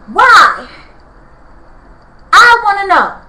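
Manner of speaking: real anger, each word punched out; real angry demand. Real, not acted. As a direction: demand an answer from the neighbors. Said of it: "I'm angry".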